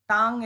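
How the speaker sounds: টাং said as one syllable ending in ng; correct pronunciation. Shown as a demonstration. Teacher drilling students on টাং, said firmly. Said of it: "এটার উচ্চারণ টাং".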